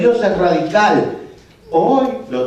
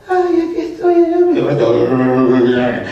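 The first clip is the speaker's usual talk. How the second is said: put-on pleading girl's voice; 4 semitones lower, much slower than usual. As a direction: plead like a trapped child. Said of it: "habla con una voz de ultratumba".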